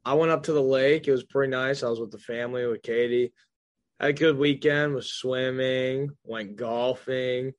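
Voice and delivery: mock drawn-out recital, phrase by phrase; copying co-host's drawl. Host copying co-host's weekend recap, mock drawn-out. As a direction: mimic a slow speaker, stretching the final words. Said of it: "stop copying me".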